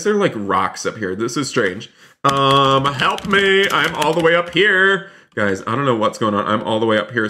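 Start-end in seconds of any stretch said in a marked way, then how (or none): 2.2-5.1 high-pitched, drawn-out plea; mock cry for help. Gamer stuck high up in the store, mock cry for help. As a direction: call down for help, playfully stranded.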